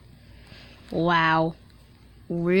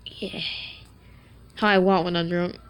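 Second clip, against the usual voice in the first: ordinary talk, nothing marked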